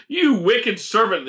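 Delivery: high, slow angry cry; roaring tyrant king. A narrator's reading. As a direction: rage at the servant. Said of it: "the king roared".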